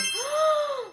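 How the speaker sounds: high, breath-in of wonder; pretend amazed gasp. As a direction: gasp as a magical guest appears. Owl appears after magic words.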